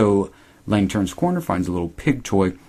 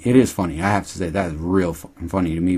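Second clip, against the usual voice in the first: ordinary talk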